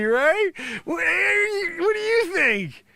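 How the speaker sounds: normal talking voice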